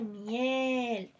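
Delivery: "miel" con la E muy estirada; vocal alargada. Shown as a demonstration. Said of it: "si quiero extender la vocal acentuada". (alarga la vocal tónica de "miel")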